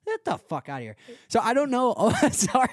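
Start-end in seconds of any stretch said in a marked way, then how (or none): 1.3-2.7 louder and higher, breaking into a laugh; laughing outburst. Real, not acted. Friend laughs at his own rant about steakhouse salads, loud laughing. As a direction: laugh it off after an aggressive rant. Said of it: "that was aggressive".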